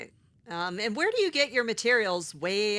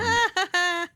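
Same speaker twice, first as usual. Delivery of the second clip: a sudden high loud laugh; burst of laughter; 12 semitones higher, 5 dB louder than usual. Real, not acted. Player laughing off the painter's insult, loud laugh.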